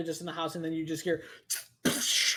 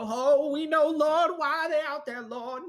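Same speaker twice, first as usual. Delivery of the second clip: high, loud, lamenting cry; wailing worshippers; 10 semitones higher, 6 dB louder than usual. Acted. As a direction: wail to the Lord in despair. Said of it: "and they go".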